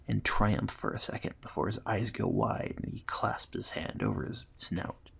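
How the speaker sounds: usual speaking voice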